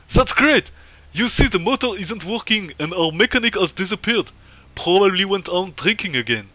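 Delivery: high, hammed-up Russian accent; big comic Russian accent, loud and swooping. Official explains the broken motor to comrade, Russian accent. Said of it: "c'est marrant avec l'accent russe".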